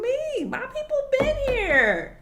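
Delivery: exasperated squeal of "My people been here". Real, not acted. Exasperated rant peak.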